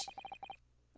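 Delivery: normal talking voice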